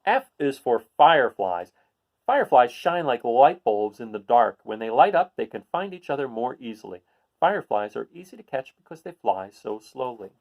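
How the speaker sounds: bright, clear picture-book read-aloud; reading to children. Acted. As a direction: read it out to a class of kids.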